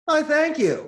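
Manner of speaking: very high, delighted gasp of thanks; flattered thanks. Retold or imitated. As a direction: gush like a pleased boss. Boss flattered by the praise.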